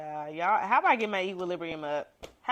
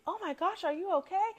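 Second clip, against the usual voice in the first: high, worried voice of a helpful stranger; a stranger checking on a fallen woman, concerned and high; 9 semitones higher, 6 dB quieter, faster than usual. Retold or imitated. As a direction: play the passerby, higher and anxious. Stranger rushing to help.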